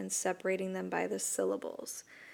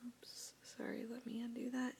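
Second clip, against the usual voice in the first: whispered aside; 4 semitones higher, 12 dB quieter than usual. Real, not acted. Aside while fixing a mistake.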